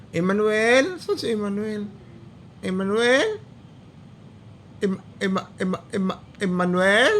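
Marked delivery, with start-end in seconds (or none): none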